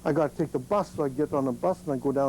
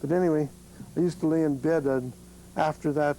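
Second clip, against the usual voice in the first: usual speaking voice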